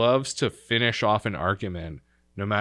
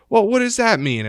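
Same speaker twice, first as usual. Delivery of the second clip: high, loud imitated challenge; mock-offended grandma demanding an explanation; indignant grandma; 13 semitones higher, 9 dB louder than usual. Grandmother challenges him, retold.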